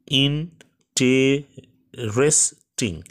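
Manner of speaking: "Interesting" very slow, broken into four separate syllables. Shown as a demonstration. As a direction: count out "interesting" with pauses between syllables. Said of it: "এখানে তিনের অধিক বার আছে".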